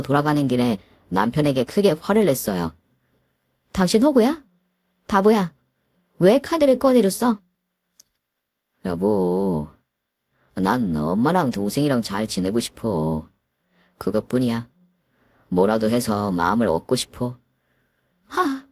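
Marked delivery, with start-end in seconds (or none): 3.7-7.4 high, sharp reproach; angry scolding. Acted. Wife scolds husband on the drive home, high and angry. 8.8-14.7 lower, timid pleading voice; meek husband. Acted. Husband pleads with his wife, lower and timid. 15.5-17.4 meek husband. Acted. Husband confides to his wife, low and earnest.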